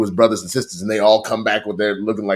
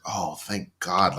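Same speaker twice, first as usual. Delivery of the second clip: mock relief; 3 semitones lower, 8 dB quieter, slower than usual. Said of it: "And I was like".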